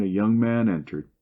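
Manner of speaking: usual speaking voice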